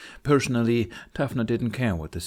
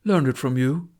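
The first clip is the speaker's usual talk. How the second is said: bright; 5 semitones higher, 5 dB louder than usual. Acted. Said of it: "Snotlout said brightly".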